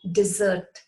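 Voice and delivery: "Desert" as dih-ZERT, the verb; second-syllable stress. Shown as a demonstration. Teacher repeats the verb for learners, "Desert" as dih-ZERT. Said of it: "spelt in exactly the same way as desert".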